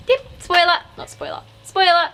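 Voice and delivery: high, loud chanted spoiler calls; playful sing-song. Pretend. Vlogger teasing viewers with spoiler calls, high sing-song. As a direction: bounce between the words like a game. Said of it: "when I'm like".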